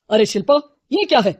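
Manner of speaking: husband snapping at his wife; lightly voiced cross husband; annoyed husband. Husband complains.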